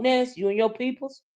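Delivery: ordinary delivery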